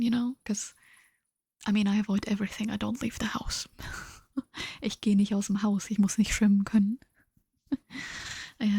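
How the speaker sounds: normal talking voice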